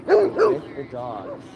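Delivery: high, slow squeaky voice for the dogs; slow falsetto baby talk for the dogs. Pretend. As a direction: pipe it up like a tiny dog. High put-on repeat to dogs.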